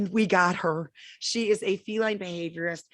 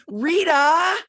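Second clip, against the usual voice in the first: excited call; 9 semitones higher, 8 dB louder than usual. Real, not acted. Greets co-host loudly.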